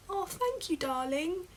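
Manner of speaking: high British mum voice; British accent. Retold or imitated. Mum thanking her daughter, high British accent. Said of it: "says it in a British accent".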